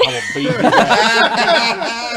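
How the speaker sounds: hammed-up furious fan, stretching each word; mock threat. Retelling the heckling fan's threat, mock furious. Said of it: "stares at Andrew".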